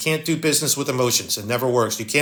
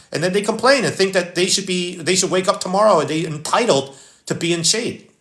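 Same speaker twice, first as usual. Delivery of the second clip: ordinary delivery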